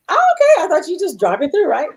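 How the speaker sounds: reacting to a friend coming to town, surprised. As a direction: sound caught off guard.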